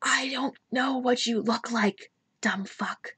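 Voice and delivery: a soft unvoiced whisper; whispered insult. Real, not acted. The player mutters back at the voice in a whisper. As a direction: hiss it under your breath.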